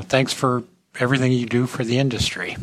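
usual speaking voice

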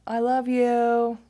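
high, loud, drawn-out singsong call; hollering. Retold or imitated. Son checking up on mom from afar, hollered affection. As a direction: call it sweetly across the house. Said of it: "So he just hollers".

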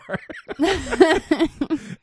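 high burst of laughter; big laugh. Real, not acted. Laughing at own story.

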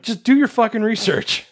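high-pitched, fed-up demand; exasperated. Real, not acted. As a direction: implore them to do their job. Ranting about sloppy research.

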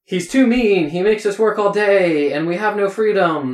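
high, loud complaining yell; hammed-up stage yell, pitched high; yelling actor. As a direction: shout it at the other actor like a stage rebel. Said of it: "one guy yelling at the other guy".

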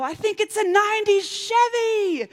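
high shouted guess; shouting to husband while sprinting. Retold or imitated. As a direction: yell it while running. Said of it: "and I go".

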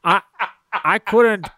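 Scottish movie-star impression; a Scottish actor stubbornly defending his accent; unbothered Scottish star telling an anecdote; Sean Connery. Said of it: "Sean Connery couldn't either".

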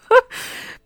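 a short unvoiced giggle; breathy high laugh. Real, not acted. Host giggling at being emotional on podcast, breathy laugh. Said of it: "I'm getting all emotional".

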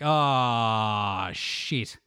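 mock dread, trailing into near-whisper; dismayed realisation. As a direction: let "Ah" sag, then drop to a hush. Imagined kid realising his embarrassment.

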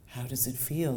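low, soft menacing hiss. Acted. Killer taunting the bound victim, a low soft hiss. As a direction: hiss it close and cold. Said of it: "the voice hissed".

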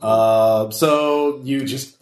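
ordinary talk, nothing marked

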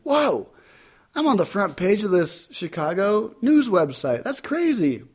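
high, amazed retold gasp of surprise; mock stunned delight; amazed whoa. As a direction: replay the shock of seeing yourself online. Seeing himself on front page.

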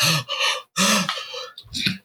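sharp gasp for air; gasping for breath. Retold or imitated. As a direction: gasp like the wind was knocked out. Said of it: "trying to catch my breath".

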